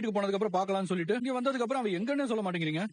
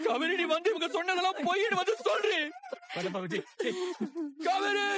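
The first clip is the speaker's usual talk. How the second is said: frantic high plea; desperate pleading; 10 semitones higher than usual. Acted. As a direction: beg her to come and deny it. Begging her to appear.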